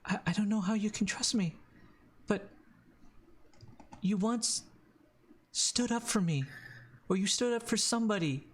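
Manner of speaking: high, halting, earnest voice; Danny. Acted. Danny pleading for Voldo's trust, high and earnest. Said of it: "she goes".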